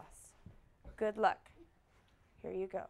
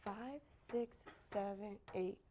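soft, low-key counting; hushed count-in; 4 semitones lower, 12 dB quieter than usual. Real, not acted. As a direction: count them in very quietly. Quiet count-in before silent counting.

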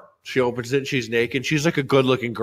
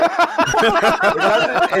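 laughing; 12 semitones higher, 3 dB louder than usual. Real, not acted. Laughing while telling story.

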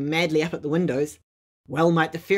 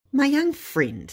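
stern and slow, pitched up high; great severity; 9 semitones higher, slower than usual. A narrator's reading. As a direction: lay down the law to the young one. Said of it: "said Badger with great severity".

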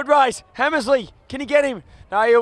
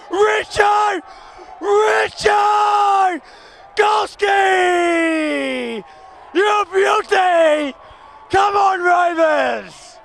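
excited goal call; 6 semitones higher, much slower than usual. Real, not acted. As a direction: scream the goal home. Goal kicked, commentator erupts.

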